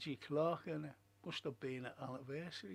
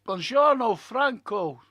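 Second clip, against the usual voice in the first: loud Italian greeting; 6 semitones higher, 19 dB louder, slower than usual. Acted. Reading viewer's Italian greeting aloud.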